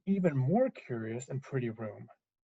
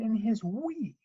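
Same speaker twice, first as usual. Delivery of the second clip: pitched up, shrinking away; wee small narration; 6 semitones higher, slower than usual. A narrator's reading. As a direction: narrate it higher and tinier. Narrator previews Baby Bear's voice.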